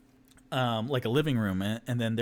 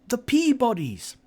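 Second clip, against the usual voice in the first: high halfling voice; 12 semitones higher, 6 dB louder than usual. Acted. Family introduces themselves.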